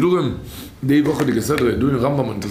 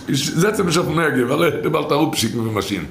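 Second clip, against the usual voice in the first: usual speaking voice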